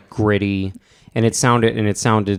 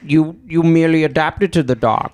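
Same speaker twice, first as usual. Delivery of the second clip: higher, hammed-up British Bane; mock Bane in a weird British hybrid accent; British Bane impression; 6 semitones higher, 4 dB louder than usual. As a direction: do the Bane line with a British twist. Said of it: "a weird British hybrid".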